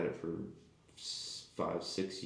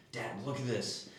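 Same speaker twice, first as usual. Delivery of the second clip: higher, lightly voiced child's call; imitating excited kid; 5 semitones higher, 4 dB quieter than usual. Kid calling dad over to the car, excited imitation. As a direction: play a kid eager to show Dad.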